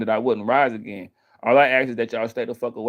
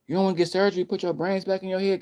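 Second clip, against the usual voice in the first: mock pleading doctor; 7 semitones higher, 3 dB quieter, faster than usual. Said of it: "and I'm like".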